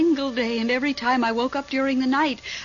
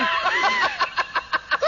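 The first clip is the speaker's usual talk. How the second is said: nervous laugh. Acted. Nervous over the eerie noise.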